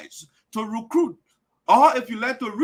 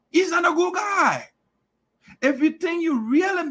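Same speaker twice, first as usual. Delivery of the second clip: shout; 8 semitones higher than usual. Real, not acted. Heated denunciation.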